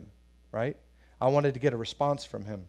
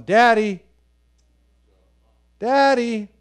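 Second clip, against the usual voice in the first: put-on high, louder little-boy call; child calling daddy; 9 semitones higher, 11 dB louder, slower than usual. Foster toddler calling the pastor, high child's daddy.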